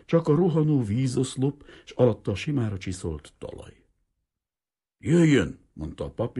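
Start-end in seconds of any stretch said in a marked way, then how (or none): 5.0-5.6 higher, louder sudden order; priest's abrupt loud call; startling command. Acted. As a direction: blurt it so loud it scares you. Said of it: "megijedt a saját hangjától".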